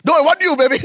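high, loud, teasing protest; loud mock denial. Spouse protesting innocence to suspicious partner, mock loud denial.

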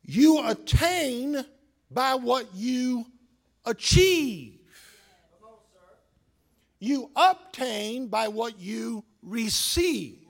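slow, measured sermon line; weighty pulpit delivery; slow preaching. Acted. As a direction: draw it out word by word.

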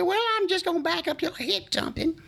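put-on quoting drunk Shorty in his voice; Shorty's voice. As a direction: squeeze it up high like a boozy old driver. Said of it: "old Shorty talked like this".

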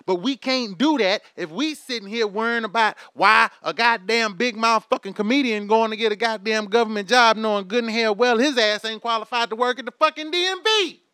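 ordinary talk

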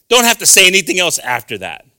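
usual speaking voice

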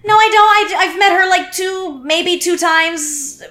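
high-pitched incredulous protest; exasperated denial. Retold or imitated. Telling police he barely knows her, exasperated.

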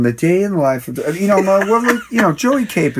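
announcer voice. Pretend. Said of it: "start talking that way".

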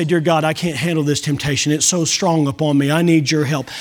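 humble low prayer. Acted. Humble plea for help.

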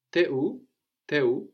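T'es où with t'es as one syllable te; contraction. Shown as a demonstration. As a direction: squeeze tu es into te. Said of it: "T apostrophe E S, te".

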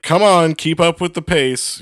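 mock a mean grown-up hurrying kids along; critical nagging. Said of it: "just being super critical and, like, kinda mean".

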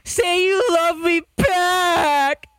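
high, very slow, pleading put-on voice; imitating Ari. Mocking a friend's voice as a joke, high and very slow. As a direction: drag it out sweetly like a needy friend. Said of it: "here's Ari".

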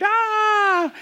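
high, held mock scream; mock child's scream. Daughter screams at dad's scary punchline, mock scream. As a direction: imitate a frightened little girl's scream. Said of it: "and she screamed".

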